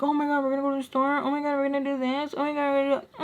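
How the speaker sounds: high, rushed mimicked grumbling; mock complaining. Daughter who would complain about the store, mock whining. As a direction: rattle off complaints in a put-on high voice.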